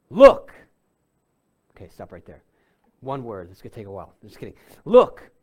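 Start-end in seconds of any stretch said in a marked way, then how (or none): 0.1-0.5 sharp, loud, rising bark of Look; older son snapping at his father, loud and rude; disrespectful shout. Retold or imitated. Older son rebuking his father, a sharp loud shout. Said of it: "doesn't address the father respectfully". 4.8-5.3 sharp, loud, rising bark of Look; older son snapping at his father, loud and rude; disrespectful shout. Retold or imitated. Older son rebuking his father, a sharp loud shout. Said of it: "doesn't address the father respectfully".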